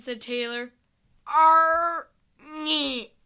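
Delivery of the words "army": drawn-out, higher, louder creature voice; a huge creature attempting to speak English; Flair's effortful English. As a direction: labour slowly through an unfamiliar word. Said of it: "trying to use proper English".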